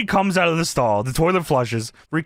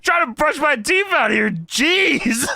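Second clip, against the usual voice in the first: higher, mock-exasperated complaint; imitating aggrieved wrestler; 7 semitones higher, slower than usual. Podcaster voicing wrestler at the sink, mock exasperation. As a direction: grumble in character, close to laughing. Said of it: "He said".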